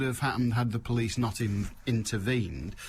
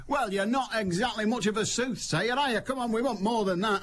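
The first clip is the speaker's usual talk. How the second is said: high, mocking voice of a fed-up mate; exasperated mate; 10 semitones higher than usual. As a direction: squeal it out like an indignant bloke in the crowd. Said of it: "And his mates went".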